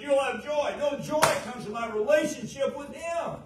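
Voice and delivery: high, loud, rising sermon peak; fiery revival preaching at top volume; preaching at full force. Acted. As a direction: preach it out with fire.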